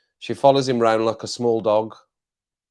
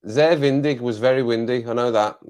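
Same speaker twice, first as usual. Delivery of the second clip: ordinary delivery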